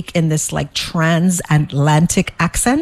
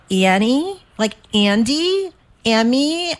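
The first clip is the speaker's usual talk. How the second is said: confused guessing; 7 semitones higher, slower than usual. Retold or imitated. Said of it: "They're like".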